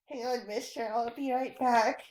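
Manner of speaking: ordinary talk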